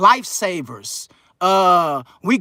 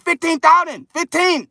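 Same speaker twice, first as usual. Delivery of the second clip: higher pitched, clipped imitation of a mad dentist with a Chinese accent; mock angry Chinese accent; 7 semitones higher than usual. Imitating the Chinese dentist haggling, mock Chinese accent. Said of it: "My little Chinaman got mad".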